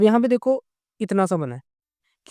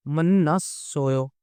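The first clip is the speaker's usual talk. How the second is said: slow demo; 3 semitones lower, much slower than usual. Shown as a demonstration.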